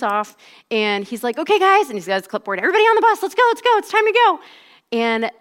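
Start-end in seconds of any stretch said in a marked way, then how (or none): 1.4-1.9 high, loud rallying call; coach calling out. Retold or imitated. Coach to his waiting runners, calling out high and loud. 2.6-4.4 high, loud, urgent herding call; coach rallying team. Retold or imitated. Coach hurrying athletes onto the bus, loud high rallying call.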